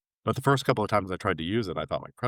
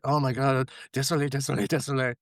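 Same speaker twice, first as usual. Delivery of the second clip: higher-pitched flustered repeated sorry; frantic apology; 6 semitones higher than usual. Retold or imitated. Traveler apologizing to inspectors she misjudged, flustered. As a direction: reenact falling over yourself to apologize. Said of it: "immediately started apologizing".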